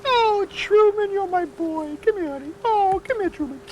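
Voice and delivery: very high, slow cooing voice to call a shy dog. Real, not acted. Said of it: "I wanna get low body language".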